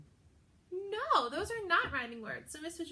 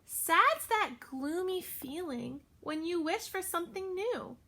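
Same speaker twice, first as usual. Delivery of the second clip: sing-song storybook read; teacher reading a picture book to her class; reading to children; 5 dB louder than usual. Acted. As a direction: read the rhyme with lilt for little ones.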